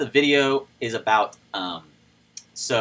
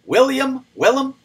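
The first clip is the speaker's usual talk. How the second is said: excited call; 13 semitones higher, 7 dB louder than usual. Real, not acted. Calling out a donor's name.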